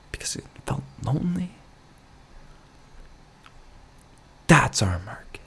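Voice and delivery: mock whisper. Vlogger pitching lonely-soup ad to viewers, mock whisper. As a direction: whisper it like a sad secret.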